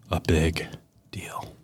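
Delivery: hushed, suspenseful whisper; dramatic whisper. Pretend. Dramatic pause before deal.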